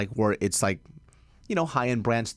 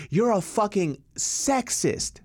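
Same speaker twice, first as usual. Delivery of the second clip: much higher, slow drawn-out mock heckle; retold heckler scream; 10 semitones higher, much slower than usual. A comic recalls a heckler screaming from the crowd, drawn-out and much higher. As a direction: stretch it out like a shrieking heckler. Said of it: "screams out in the middle".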